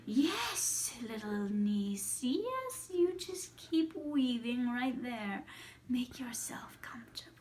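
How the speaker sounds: slow witchy coaxing, swooping up on yes; old witch lulling her prey; Baba Yaga. Acted. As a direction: draw it out with false sweetness. Baba Yaga coaxes her niece.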